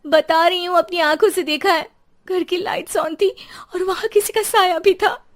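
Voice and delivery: high, agitated insisting; insistent. Acted. Wife insisting to neighbours she saw it, agitated. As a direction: push back, upset and insistent.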